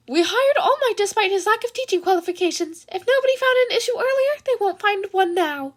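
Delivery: a chirpy principal shrugging off rules; Nezu voice. Acted.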